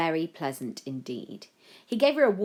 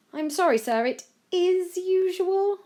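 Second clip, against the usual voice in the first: apologetic; 7 dB louder than usual. A narrator's reading. Aide apologises to outraged boy.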